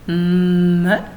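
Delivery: "M" as a long loud mmm released into a short vowel; letter sound. Shown as a demonstration. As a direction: hold the hum of "M". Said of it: "un M".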